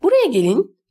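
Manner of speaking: high, piercing summons; shrill voice. A narrator's reading. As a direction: call them in with a shrill voice. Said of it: "Tiz bir ses".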